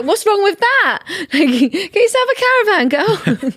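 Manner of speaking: high, loud comic outrage breaking into laughter; mock indignant. Defending her caravan holidays to her friend, laughing mock indignation.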